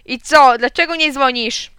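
loud, high demand; yelling. A narrator's reading. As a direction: yell an impatient question down the phone. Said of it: "Alcest wrzasnął". (głośny, wysoki wrzask)

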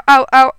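high, loud yelps of "au, au"; mock cry of pain. A narrator's reading. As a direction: yelp like a man who hurt his knee. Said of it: "Przez chwilę krzyczał".

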